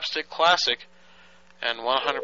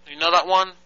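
ordinary delivery

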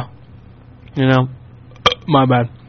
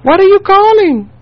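very high, louder falsetto caller; caller impression; 4 dB louder than usual. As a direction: mimic the confused caller, squeaky. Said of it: "He's like".

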